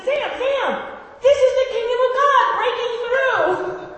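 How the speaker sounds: high, loud, laughing excitement; excited. Retold or imitated. Preacher telling Sam at dinner, loud laughing excitement. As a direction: burst out delighted to a friend.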